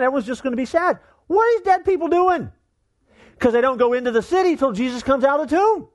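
high-pitched, incredulous preaching; amazed preaching. Real, not acted. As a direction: retell it in astonishment, pitched high, with pauses for effect. Preacher excitedly retells bizarre passage.

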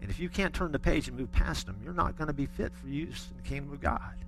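put-on hushed, measured warning with a light Southern accent; imitated southern Oklahoma-Texas accent. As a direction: quietly lay down the law as an Oklahoma-Texas professor. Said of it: "he had that southern Oklahoma, Texas wrapped-up accent".

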